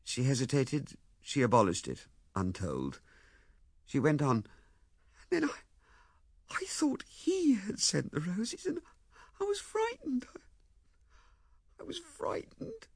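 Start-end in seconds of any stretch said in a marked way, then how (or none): 5.3-12.9 high, halting, tearful confession; tearful, frightened. Acted. Ethel confesses to Lewisham she was frightened, tearfully. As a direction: falter in a small high voice, dropping towards a whisper.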